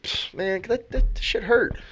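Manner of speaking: high-pitched mock pained whine; hurting player. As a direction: imitate a batter complaining of pain. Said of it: "'cause it hurts when you hit".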